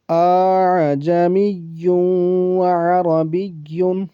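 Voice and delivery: آ، آ with an eased hamza, then جا می یوں drawn out slowly; softened hamza reading. Shown as a demonstration. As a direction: demonstrate the gentle tasheel reading, very slowly. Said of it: "ایسے پڑھنا ہے اس کو".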